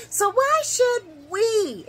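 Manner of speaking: we drawn out high and slow; stress on we. Shown as a demonstration. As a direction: lean hard on "we". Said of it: "and I highlighted we".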